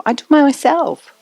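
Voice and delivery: put-on a stubborn little kid demanding to do it alone; high, slow, childish insistence; insistent toddler.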